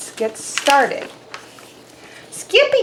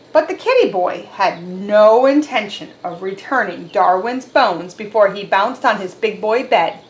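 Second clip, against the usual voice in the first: usual speaking voice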